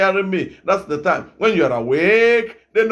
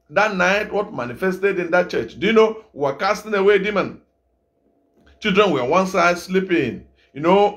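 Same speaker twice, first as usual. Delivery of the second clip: usual speaking voice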